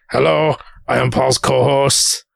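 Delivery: slow, put-on stranger's voice; disguised voice. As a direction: fake a different co-host, slowly. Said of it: "you could disguise your voice".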